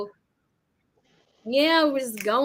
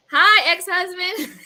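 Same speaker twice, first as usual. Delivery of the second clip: laughing greeting; 8 semitones higher, 4 dB louder, slower than usual. Real, not acted. Greeting ex-husband watching the chat.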